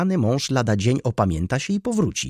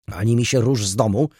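raised, louder command; stern order; 4 semitones higher, 6 dB louder than usual. A narrator's reading. Baroness forbidding the maid to go out, stern order. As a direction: forbid it firmly.

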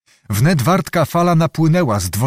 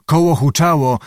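ordinary delivery, nothing marked

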